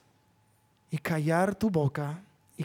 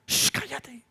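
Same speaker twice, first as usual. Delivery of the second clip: put-on hushing a child; 6 semitones higher, 4 dB quieter than usual. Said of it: "¿qué les decimos?".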